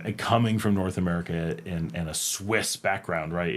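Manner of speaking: usual speaking voice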